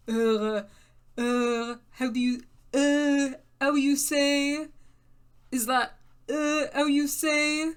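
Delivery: slow, groping cartoon French how you say; mock French accent. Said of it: "trying to make it more like an uh".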